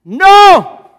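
huge high yelled no; shout. Acted. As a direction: scream the refusal at full force. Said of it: "Pedro grita".

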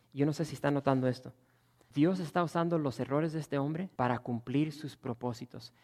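ordinary delivery

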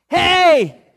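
excited yelling across the wall; shouting lepers. Acted. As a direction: call out the news to the gate.

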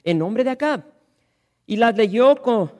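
loud, ringing Bible reading; scripture reading. Acted. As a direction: proclaim the text to the congregation. Reads scripture aloud.

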